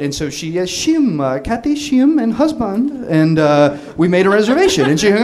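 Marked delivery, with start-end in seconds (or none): 0.6-3.1 imitating a thick Korean accent, higher; hammed-up Korean accent giving the booking name. Wife booking the room, in a put-on Korean accent. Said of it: "pours it on so thick".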